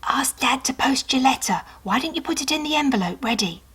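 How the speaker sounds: mother whispering quickly as she leaves; whispering mum. A narrator's reading. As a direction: whisper a reminder on the way out. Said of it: "she whispered".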